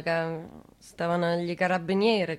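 usual speaking voice

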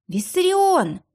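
desperate high shout of his name; high cry out. A narrator's reading. Эльвира кричит, бросаясь к нему. (отчаянный высокий крик)

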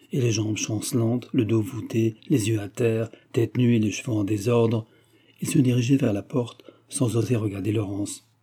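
usual speaking voice

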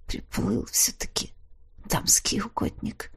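soft unvoiced aside; weak whispered sarcasm; faint whisper. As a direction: whisper it dryly to herself. Heroine's faint thought.

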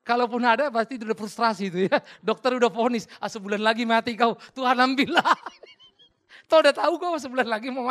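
loud, laughing mock of a desperate prayer; loud mocking preacher. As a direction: poke fun loudly at the last-minute prayer. (ejekan keras sambil tertawa)

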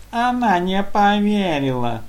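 high, slow squeak mimicking the excuse; squeaky mocking mimic. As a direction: parrot it back in a mocking squeak. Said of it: "передразнивая оппонента, пропищало".

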